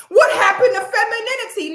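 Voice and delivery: mocking mimic. Mimicking the woman's taunt.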